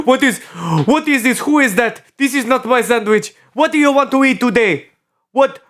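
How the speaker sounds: high, hammed-up fake African accent; attempted African accent. Shown as a demonstration. Player trying an accent on puzzled cohost, high mock African. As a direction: badly fake African, landing near Russian. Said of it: "I'm trying to sound African".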